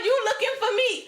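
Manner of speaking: high, loud mock baby calling out; hammed-up hungry baby; squeaky baby cry voice. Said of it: "She cried".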